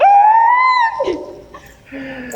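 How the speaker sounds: a high, loud, screaming laugh; overjoyed shriek of laughter. Acted. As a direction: burst into a squealing laugh of joy. Daughter gets car from dad.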